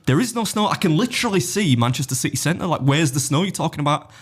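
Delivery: high-pitched incredulous protest; mock-exasperated challenge to the pilot's story; indignant disbelief. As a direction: retell your outrage, starting high and insistent. Acting out disbelief at window.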